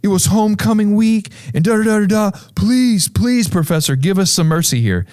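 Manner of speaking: high-pitched mock begging; imitated pleading, easing down toward the end; pleading students. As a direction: beg for mercy as an apologetic student. Students beg professor for mercy.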